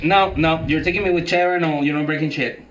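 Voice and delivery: agitated. Acted. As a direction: insist in loud panic. Moses refuses, agitated.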